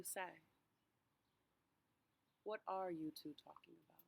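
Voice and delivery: unhurried meditation voice with long pauses; guided meditation. Real, not acted. Pastor walking congregation through a walk to Emmaus, guided meditation. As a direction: guide listeners gently, phrase by phrase, leaving space to breathe.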